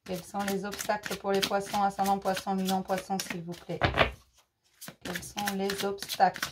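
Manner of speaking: soft tarot incantation; murmured invocation. Real, not acted. Murmured request to the cards.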